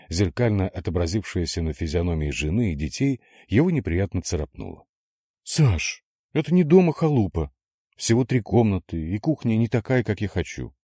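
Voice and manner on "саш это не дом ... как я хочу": categorical. A narrator's reading. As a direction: shut it down, no arguments. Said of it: "категорично раскритиковала".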